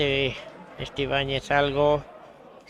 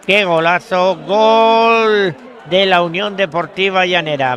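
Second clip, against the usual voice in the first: loud, high, excited goal call; breakneck goal commentary; 5 semitones higher, 9 dB louder than usual. Real, not acted. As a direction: shout the goal like a live commentator.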